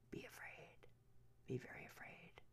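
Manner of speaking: breathy ominous whisper, very soft; spooky whisper. Acted. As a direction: whisper it like a horror trailer. Ominous warning to viewers.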